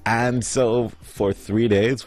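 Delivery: normal talking voice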